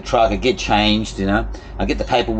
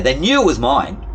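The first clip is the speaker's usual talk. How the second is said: raised, loud and emphatic, rising; indignant insistence; 5 semitones higher, 4 dB louder than usual. Real, not acted. Worker wronged by workmates who binned his things, indignant insistence. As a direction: insist on it, wound up. Insists angrily, then repeats resigned.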